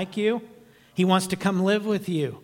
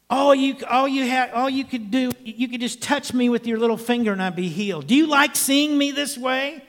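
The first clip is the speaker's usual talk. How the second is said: raised, higher pleading yell; louder, higher mock of the yell; retold angry outburst at God; 6 semitones higher, 3 dB louder than usual. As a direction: demand it of God, hurt and angry. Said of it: "I remember yelling at Him".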